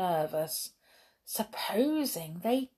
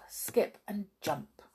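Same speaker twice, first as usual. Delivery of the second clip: plain reading voice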